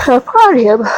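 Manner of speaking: speaking through a yawn. Real, not acted. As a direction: yawn while reading. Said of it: "Sorry, I keep yawning".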